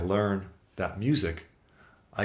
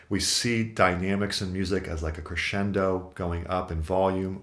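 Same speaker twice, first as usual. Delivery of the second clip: ordinary talk, nothing marked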